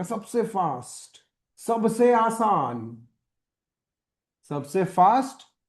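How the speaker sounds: each सबसे drawn out slowly; slow emphasis. Shown as a demonstration. Teacher stresses the point slowly to students. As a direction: stretch every phrase to drive it home. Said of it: "सबसे fast तरीका".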